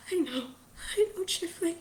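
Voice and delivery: high, breathy acknowledgement; weepy and wavering; tearful mother. Acted. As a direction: answer through tears, tenderly. Tearful mother answers her son.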